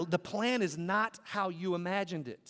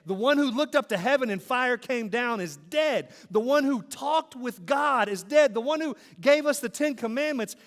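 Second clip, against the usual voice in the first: louder and higher with each line, hammering "dead"; preaching at full force; 5 semitones higher, 6 dB louder than usual. Acted. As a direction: build like a preacher calling out to the congregation.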